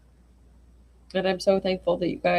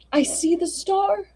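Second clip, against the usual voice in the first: cried out; 13 semitones higher than usual. Acted. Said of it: "he cried".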